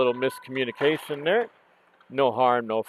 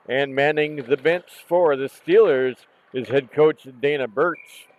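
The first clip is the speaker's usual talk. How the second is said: usual speaking voice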